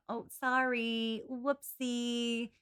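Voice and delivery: slow, sugary mock-sorry voice, slightly high; mock Lucy's insincere innocence; fake apology. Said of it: "got this veneer of".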